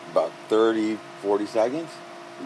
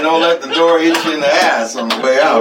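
sarcastic; 7 semitones higher, 10 dB louder than usual. Retold or imitated. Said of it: "that's the same as saying".

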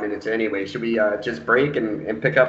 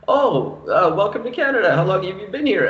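mock friendly welcome; 7 semitones higher than usual. Acts out greeting an immigrant.

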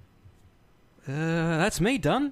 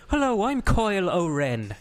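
higher, slow mock Irish accent; 6 semitones higher than usual. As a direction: put on a playful Irish brogue, drawing it out. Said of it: "Sounds like an Irish guy".

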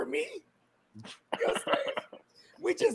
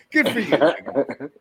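laughing; 6 semitones lower, much slower than usual. Real, not acted. Laughing shout-out to Pruitt.

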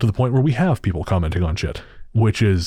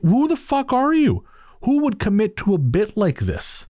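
high, drawn-out disbelief; incredulous outburst; 12 semitones higher, slower than usual. Acted. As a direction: stretch it high in sheer exasperation. Mocking the sceptics with disbelief.

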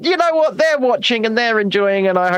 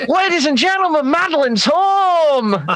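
mock announcer; 6 semitones higher than usual.

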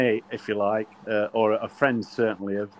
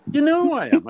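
high, louder indignant retort; 5 dB louder than usual. Retold or imitated. Captain asked for his name, indignant. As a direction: retort with pitch leaping up in mock outrage.

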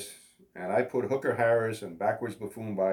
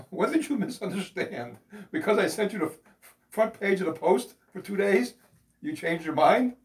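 indignant; 8 semitones higher than usual. Retold or imitated. Indignant retort to Facebook.

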